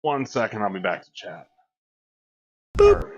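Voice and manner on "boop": squeaky loud boop; high boop. Real, not acted. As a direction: pop out a silly boop. Boop sound, laughing.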